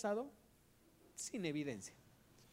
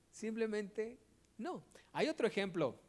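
usual speaking voice

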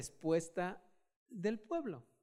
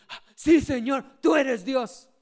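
loud acclamation; 3 semitones higher, 10 dB louder, slower than usual. Pretend. Congregation imagined crying out that God is real, loudly. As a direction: ring out with a loud cry of faith. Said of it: "podamos decir".

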